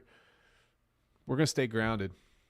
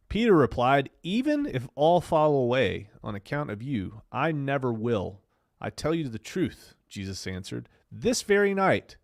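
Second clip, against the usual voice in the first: Bible story read with big swings in pitch; dramatic scripture reading; 5 dB louder than usual. Acted. Streamer telling viewers a Bible story, dramatic reading. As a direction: declaim the verses, leaning hard on key words.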